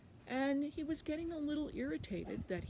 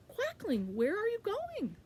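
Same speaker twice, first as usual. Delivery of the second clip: higher, sing-out storybook voice; calling ladder; 12 semitones higher, 4 dB louder than usual. Acted. Ladder calling to passing Quackling, high questioning voice. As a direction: call out curiously, pitch swooping up. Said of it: "all of a sudden he heard".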